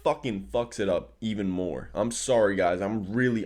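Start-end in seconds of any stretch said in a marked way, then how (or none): none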